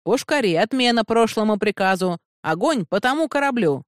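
high, urgent call to the gunners; commanding cry across the ship; captain's shouted order. A narrator's reading. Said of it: "прокричал капитан".

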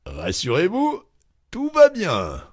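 high, rising then loud call; all-clear announcement. A narrator's reading. Loiseau reassuring the startled diners, calling out the all-clear. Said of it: "reprit de sa voix naturelle".

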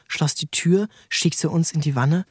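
usual speaking voice

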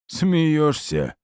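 high, scornful snort of a question; scoffing. A narrator's reading. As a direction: scoff at the very idea. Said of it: "фыркнул Титан".

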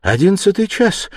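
high sudden exclamation; young woman startled, voice jumping up; cry of alarm. Acted. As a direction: cry out at the late hour. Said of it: "вскрикнула она".

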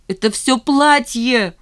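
slow, high, loud wail; despairing cry. A narrator's reading. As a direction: cry out desperately, stretching the words. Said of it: "в отчаянии закричала Жанна". (отчаянный протяжный крик)

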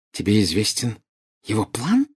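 incredulous query, rising at the end; questioning disbelief. A narrator's reading. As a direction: check what you just heard. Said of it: "переспросила Белатриса". (переспрашивая с недоверием)

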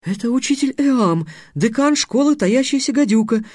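put-on high, eager girl's voice; delighted high-voiced introduction; joyful exclamation. A narrator's reading. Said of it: "радостно воскликнула Тиана".

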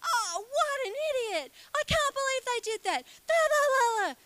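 high, hammed-up mock fury; mock rant ending in sing-song la la; losing it. Someone ranting about an unpaid debt, high mock fury. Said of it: "You'd totally lose it".

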